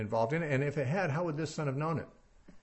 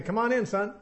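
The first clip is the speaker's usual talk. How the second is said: simple request; 7 semitones higher than usual. Shown as a demonstration. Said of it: "just say".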